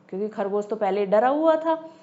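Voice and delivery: ordinary delivery, nothing marked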